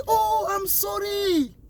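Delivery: slow, high mock apology "Oh, I'm sorry"; drawn-out sheepish apology in English; man apologising to the young driver, high and slow; imitating the man. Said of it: "il dit".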